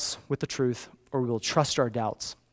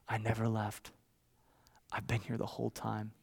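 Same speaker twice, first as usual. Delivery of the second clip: soft, lower, tender voice; gentle reassurance; 3 semitones lower, 10 dB quieter than usual. Retold or imitated. Father hugs his weeping son, soft reassurance. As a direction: soothe a crying child quietly.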